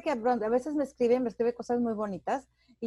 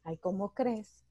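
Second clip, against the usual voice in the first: bashful brush-off; 4 semitones lower, 7 dB quieter, much slower than usual. Retold or imitated. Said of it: "yo volteaba y decía".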